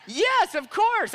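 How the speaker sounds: high, slow, over-eager yes; mock eager yes. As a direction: play the gushing host. Imagined eager invitation.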